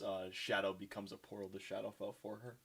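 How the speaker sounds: usual speaking voice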